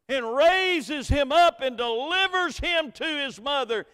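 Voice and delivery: loud, high preaching climb; full-force preaching. Acted. Pastor preaching hope at a funeral, loud and high. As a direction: preach it out to the congregation.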